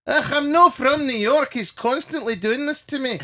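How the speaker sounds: high, loud mock complaint; acted-out indignant protest, pitched right up; exasperated denial. Telling the driver he's not from New York, exasperated denial.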